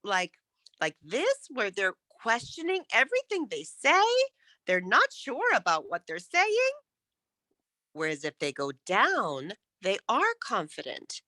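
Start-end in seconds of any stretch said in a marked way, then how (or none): 0.8-6.7 "this", "say" and "saying" swung up high like questions; questioning uptalk. Shown as a demonstration. Coach mimicking people who question everything they say, uptalk. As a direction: lift the end of each phrase as if unsure. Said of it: "some people talk like".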